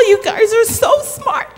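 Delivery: ordinary talk, nothing marked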